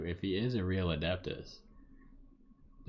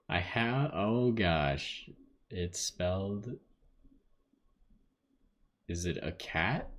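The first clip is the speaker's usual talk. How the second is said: ordinary talk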